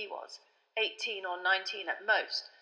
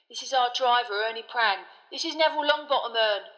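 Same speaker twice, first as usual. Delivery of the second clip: put-on young conductor voice; 3 semitones higher, 5 dB louder than usual. A narrator's reading. Stan voiced in the story.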